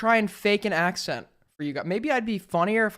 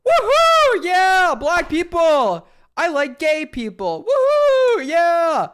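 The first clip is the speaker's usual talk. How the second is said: loud, much higher whooping; mock crowd cheer; 9 semitones higher, 8 dB louder, slower than usual. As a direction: whoop like an over-eager audience.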